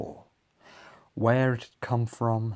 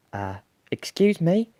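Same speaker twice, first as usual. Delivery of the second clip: high little voice, trying once more; tentative, raised small-creature voice; 9 semitones higher, 4 dB louder than usual. A narrator's reading. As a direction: pipe up politely, rising. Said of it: "in a very small voice".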